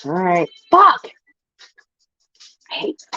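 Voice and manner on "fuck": sudden loud swear; frustrated shout. Real, not acted. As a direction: yell it out, fed up. Frustrated outburst.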